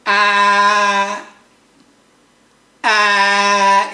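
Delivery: sustained flat first-tone "ah", loud and even. Shown as a demonstration. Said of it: "you speak bit long".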